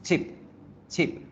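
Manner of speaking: "chip" with a quick, relaxed i; brief, abrupt "chip"; short i. Shown as a demonstration. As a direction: cut the vowel short in "chip".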